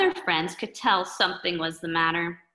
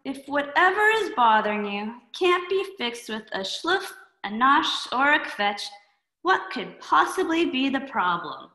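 put-on higher drowsy girl voice; a sleepy girl asking what's wrong; lightly voiced sleepy girl; sleepy Schluffy Girl; 5 semitones higher than usual.

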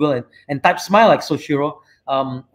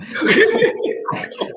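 high airy laugh. Real, not acted. Laughs at own question.

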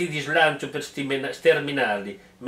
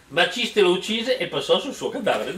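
normal talking voice

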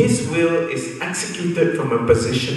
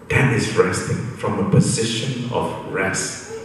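ordinary delivery

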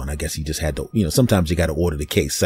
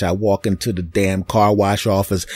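usual speaking voice

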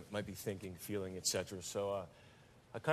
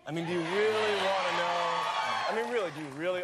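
louder, laughing teasing shout; 5 semitones higher, 6 dB louder than usual. Real, not acted. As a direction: shout the question through laughter. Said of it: "teasing build to a shout".

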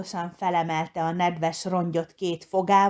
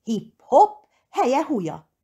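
excited cheer; 7 semitones higher, 6 dB louder than usual. A narrator's reading. Piglet running, calling out.

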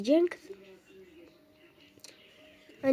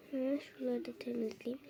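whispered aside to herself; soft whispered self-talk; 13 dB quieter than usual. Real, not acted. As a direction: whisper it while cleaning. Muttering to herself while cleaning.

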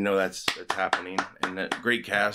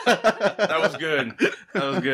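laughing; 9 semitones higher, 5 dB louder, slower than usual. Real, not acted. Laughing at headline.

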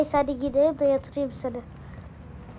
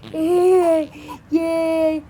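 put-on baby cheering; 4 semitones higher, 10 dB louder than usual.

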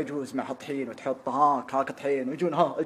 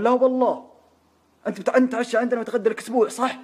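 a hint of a pushy nobleman, higher; إلحاح البرمكي; 8 semitones higher, 5 dB louder than usual. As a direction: press the host, half-teasing. البرمكي يصر على الدعوة. (إلحاح مرح بصوت أعلى قليلاً)

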